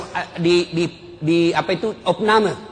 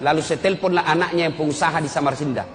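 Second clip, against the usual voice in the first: ordinary delivery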